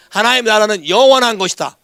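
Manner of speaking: ordinary talk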